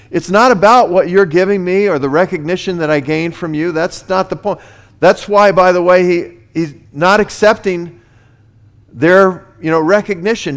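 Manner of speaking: loud, rising pulpit delivery; thundering sermon voice; full-force preaching. Acted. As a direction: preach it out with fire.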